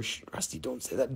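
real whispered hush; whispering to Rusty to stay quiet; hushed shush. Real, not acted. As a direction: shush him under your breath so the viewers miss it. Hushing his partner on stream.

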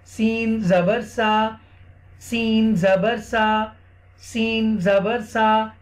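सीन जबर सा drawn out, long ee in सीन; correct pronunciation repeated. Shown as a demonstration. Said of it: "सही pronounce करना है".

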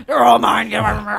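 loud, possessive yell; greedy shout. Acted. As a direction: bellow it like a glutton. Greedy claim on the bagels.

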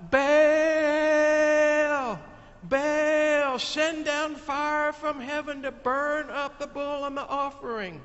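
high, loud, drawn-out call to Baal; crowd yelling to a god. Acted. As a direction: bellow the name upward like a desperate crowd. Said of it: "all the people yelled out".

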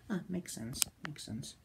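very soft mutter to oneself; quiet realization. Retold or imitated. Confused viewer accepting the blackout explanation, muttering. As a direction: trail it off under your breath.

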